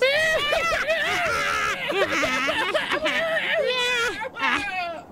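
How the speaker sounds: very high, triumphant cackle; villain cackle. Acted. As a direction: cackle in shrill victory. King Jim triumphs over hags.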